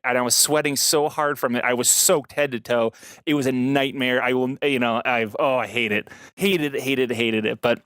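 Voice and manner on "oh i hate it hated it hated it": usual speaking voice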